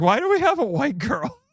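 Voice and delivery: high-pitched, incredulous question; baffled reaction. Retold or imitated. Host recalling own reaction while watching, high-pitched mock surprise. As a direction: jump up high in mock bewilderment. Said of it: "I was like".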